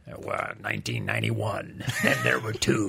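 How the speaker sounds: low, booming trailer-announcer impression; movie trailer voice. Mocking the one bloke who voiced every nineties trailer, low announcer voice. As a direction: do the big deep voice from the old trailers. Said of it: "the same bloke voiced all of them".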